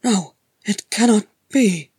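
loud, stunned denial; disbelief. Acted. Gimli faces an impossible sight, disbelieving. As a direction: refuse to believe it, voice up. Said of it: "Gimli said in disbelief".

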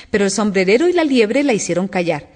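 usual speaking voice

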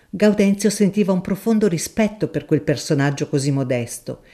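plain reading voice

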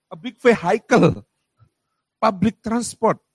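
loud slow explaining, every word spelled out; slow it down and push it out so a stranger finally gets it; explaining loudly. Retold or imitated. Traveller explaining bus to a baffled local, loud and slow.

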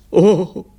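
high, loud "oh" of pain; pained cry. Acted. Bitten director letting go, high pained cry.

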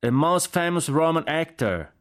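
high, slow, carrying shout; shouted answer. A narrator's reading. Jack shouts explanation to the general, shouted answer. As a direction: holler it out with stretched words. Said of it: "gridò Jack".

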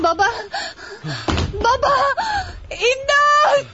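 high, frantic screaming; panicked cries for help. Acted. Daughter calls Conchita to her collapsed father, panicked cries. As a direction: cry out in rising panic.

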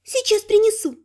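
put-on high, chirping elf girl; chirpy elf. A narrator's reading. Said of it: "прощебетала эльфийка".

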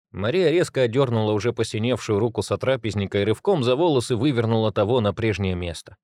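ordinary delivery, nothing marked

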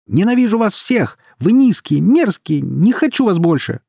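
very high, loud tantrum; furious yelling. A narrator's reading. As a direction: scream it at the whole family. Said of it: "орал Май".

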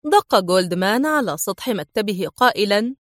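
usual speaking voice

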